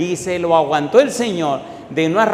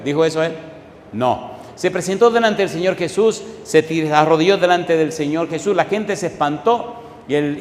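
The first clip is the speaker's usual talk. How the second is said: ordinary delivery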